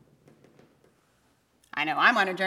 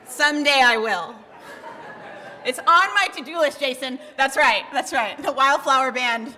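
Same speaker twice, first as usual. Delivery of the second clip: loud, high, through laughter; amused, excited; 4 semitones higher, 9 dB louder than usual. Real, not acted. Preacher joking to congregation about a metal song, loudly amused. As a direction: call out over the laughs with real glee.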